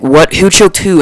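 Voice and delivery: loud, alarmed questioning; shocked teacher. Retold or imitated. Teacher demands who choked whom.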